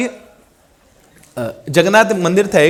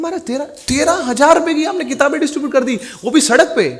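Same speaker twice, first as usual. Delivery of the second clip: much higher, bursting with pride; eager emphatic answer; 9 semitones higher than usual. Retold or imitated. Devotee eagerly tells the guru the sum, pitched high. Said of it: "तो महाराज इतने खुश हुए".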